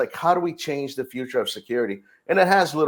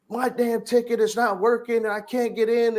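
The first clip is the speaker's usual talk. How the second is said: retold upset guest, voice pitched up; freaking out; 10 semitones higher than usual. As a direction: mildly mimic a frustrated dad at the gate. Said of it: "he's freaking out".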